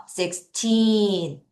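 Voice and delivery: put-on Sixteen with a long, strong TEEN; six-TEEEN, ending held; stress on TEEN. Shown as a demonstration. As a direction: stretch the second syllable of Sixteen. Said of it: "강하게 읽어주셔야 돼요".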